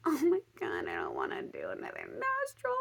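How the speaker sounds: hammed-up reluctant moan; mock whining. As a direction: whine in dread, stretching the words. Dreading second nostril, mock whining.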